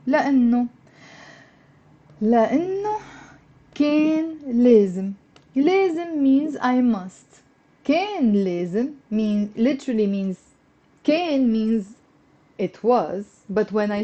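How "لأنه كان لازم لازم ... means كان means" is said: ordinary talk, nothing marked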